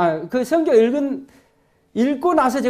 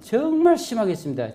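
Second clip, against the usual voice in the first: ordinary talk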